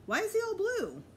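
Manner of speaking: high, loud alarmed question; shocked and worried. A narrator's reading. Father seeing the blue dog, alarmed.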